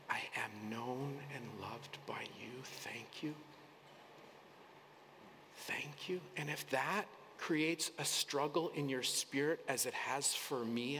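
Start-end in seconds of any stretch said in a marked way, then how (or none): 0.1-6.2 soft unvoiced prayer; whispered prayer. Shown as a demonstration. Preacher modeling a quiet thank-you prayer. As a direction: pray it under your breath.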